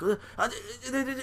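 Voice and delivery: high, stammering mock sobbing, swelling to a wail; hammed-up tearful whine, words dragged out at the end; whining and crying. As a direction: blubber like a tattling crybaby. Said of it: "he's, like, whining and crying".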